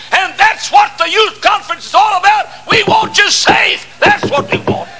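preacher shouting to the youth; full-force preaching. Acted. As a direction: drive it home to the crowd.

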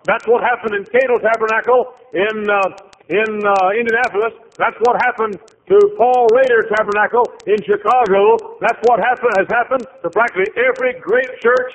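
high and loud preaching; full-force preaching. Acted. Preacher warns congregation about losing the church, loud and fervent. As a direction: preach it with rising fervor.

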